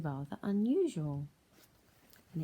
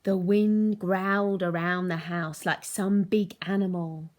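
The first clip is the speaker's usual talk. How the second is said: louder, slow rumbling read; dramatic narration; 10 dB louder, slower than usual. A narrator's reading. Teacher reading storm scene, loud rumbling narration. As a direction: make it rumble like a beast.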